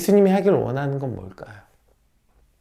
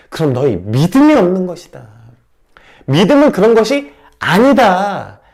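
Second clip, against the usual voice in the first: strong rebuke; 5 semitones higher, 9 dB louder than usual. Acted. Jesus rebuking the disciples, loud firm rebuke. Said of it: "이렇게 강력하게 책망하고 있는 것입니다".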